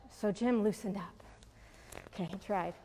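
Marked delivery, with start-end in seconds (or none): none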